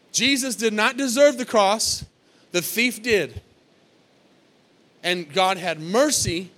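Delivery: normal talking voice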